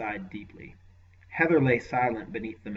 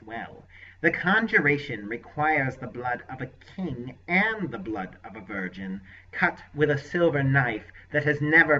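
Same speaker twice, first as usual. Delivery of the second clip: raised, lightly oily sorcerer voice; voiced sorcerer; 3 semitones higher, 7 dB louder than usual. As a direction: play the scheming sorcerer, mild. Sorcerer explains conjuring.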